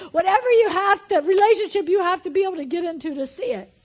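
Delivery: high, loud preaching shout; full-force preaching. Real, not acted. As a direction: drive it home at full voice.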